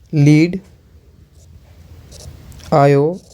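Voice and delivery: slow word-by-word dictation, each word repeated; slow, halting classroom dictation with long pauses. Shown as a demonstration. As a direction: dictate it slowly so the class can copy it down. Teacher dictates observation while writing.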